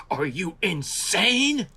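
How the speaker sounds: high, slow, drawn-out astonished question; mock disbelief. Old master peering through a microscope, slow amazed disbelief. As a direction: stretch it out in amazed admiration. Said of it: "and he goes".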